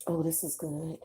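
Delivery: quiet words between sips of coffee; while sipping a drink. Real, not acted. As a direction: speak right after a sip. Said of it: "I'm sipping my coffee".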